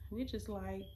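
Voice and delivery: usual speaking voice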